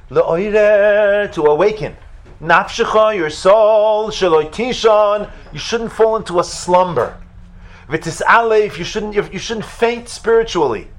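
high, loud, impassioned call to the soul; soaring loud exhortation; fervent preaching. Acted. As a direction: cry it out like a rousing sermon. Preaching at full force.

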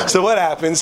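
ordinary talk, nothing marked

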